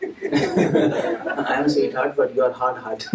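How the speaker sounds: slow mock complaint broken by laughter; laughing girlfriend. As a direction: tease him through a laugh. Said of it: "what that sweetheart will say".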